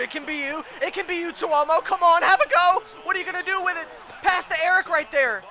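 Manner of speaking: high, mounting urgency; high, loud cheering-on; excited urging. Real, not acted. Urging player on a rush.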